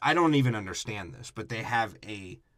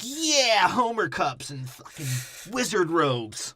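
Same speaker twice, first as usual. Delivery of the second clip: higher, slow, put-on fan voice; mock Disney fan; 7 semitones higher, much slower than usual. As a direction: lightly ham up a smug theme park fan. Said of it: "They're like".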